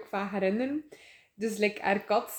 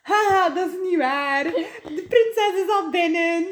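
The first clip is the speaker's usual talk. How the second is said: high, loud mocking laughter; mocking guard laugh; 8 semitones higher, 6 dB louder, slower than usual. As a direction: laugh her off like a smug guard. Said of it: "En de wachter zegt".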